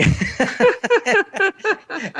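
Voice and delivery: a high, real laugh at his own joke; a real high laugh after the punchline; high burst of laughter. Real, not acted.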